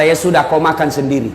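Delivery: soft, low, kindly giving in; the Prophet gently giving in, soft and low; gentle concession. Acted. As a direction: let it go with a soft smile.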